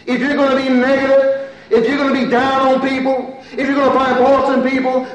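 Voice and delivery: high, forceful pulpit warning; preach. Real, not acted. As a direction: drive each clause home at full force.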